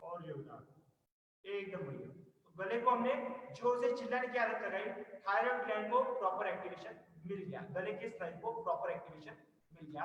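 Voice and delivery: quiet reassuring teacher voice; soft calm guidance. Real, not acted. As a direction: ease the class back down gently. Calming after shouting.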